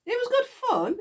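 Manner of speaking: high, bright burst of delight; gleeful. Real, not acted. Gleeful recollection, then repeated plainly.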